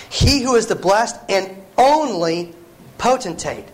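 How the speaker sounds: "only" drawn out slowly, set apart before "potentate"; stress on "only". Real, not acted. Preacher reading Paul to the congregation, stressing "only". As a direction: lean hard and slow on "only". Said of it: "I want to emphasize the word "only".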